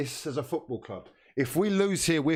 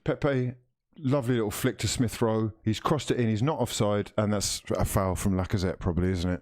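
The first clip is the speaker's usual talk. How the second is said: TV match commentator voice; live commentary; 5 semitones lower than usual. Acted. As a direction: call the match like a broadcaster. Commentary on the replay.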